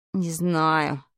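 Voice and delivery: low, subdued cross answer; reply low, a touch grumpy. A narrator's reading. Vadik rubbing his bumped head, mildly cross. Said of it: "сердито ответил приятель".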